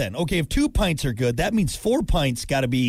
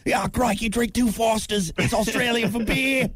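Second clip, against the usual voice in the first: higher, jokey Australian beer-ad voice; mock Australian; 4 semitones higher than usual. Host mocking an Australian scientist, mock Australian accent. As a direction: ham up an Australian pitching beer. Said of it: "a guy that sounds like that".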